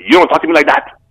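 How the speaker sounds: high, loud parody of a fake Jamaican accent; mock the actor's botched Jamaican accent; bad Jamaican accent imitation. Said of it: "That's not how Jamaicans talk".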